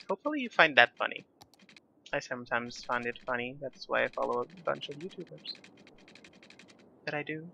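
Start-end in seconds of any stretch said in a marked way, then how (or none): none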